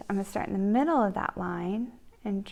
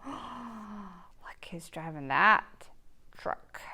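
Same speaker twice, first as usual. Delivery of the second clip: slow, hushed whisper; whispered reveal; 7 dB quieter, much slower than usual. Acted. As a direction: whisper the surprise ending. Ending reveal.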